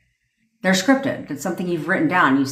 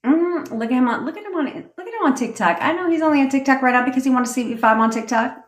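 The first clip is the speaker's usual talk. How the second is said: much higher, smug and quick; conceited tonality; 7 semitones higher, faster than usual. Shown as a demonstration. Coach showing how to put yourself on a pedestal, smug and high. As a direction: toot your own horn, fully sure of yourself. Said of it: "See what I'm saying? Tonality".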